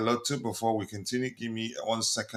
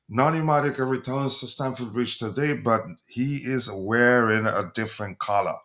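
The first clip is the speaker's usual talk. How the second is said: ordinary delivery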